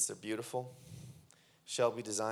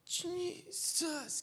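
"Jesus" said high and faint, first sound unformed; a hushed mimic of her first try; imitating the girl's first word; 10 semitones higher, 11 dB quieter than usual. Pastor mimics the deaf girl's first attempt at a word. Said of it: "Can you say Jesus?".

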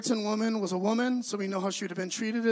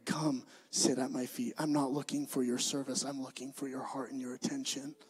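gentle Jesus voice; 6 semitones lower, 5 dB quieter than usual. Acted. Preacher voicing Jesus inviting Martha to his feet, low and soft. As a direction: lower and softer, like a tender invitation.

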